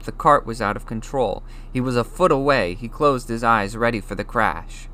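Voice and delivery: plain reading voice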